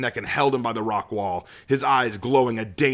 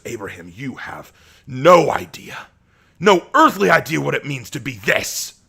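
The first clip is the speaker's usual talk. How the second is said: snarling rage; 4 semitones higher, 6 dB louder than usual. A narrator's reading. Asher snarling at Abraham, shaking with rage. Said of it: "Asher snarled, practically shaking with rage".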